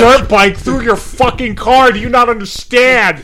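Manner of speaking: loud, high-pitched exasperated scolding; angry mom. Acted. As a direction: yell at the kid like an outraged parent. Acts out mom scolding the kid.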